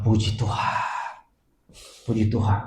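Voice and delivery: soft breathy relief, almost whispered; relieved whisper. Retold or imitated. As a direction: whisper thanks to God under your breath. Relieved after seeing child alive.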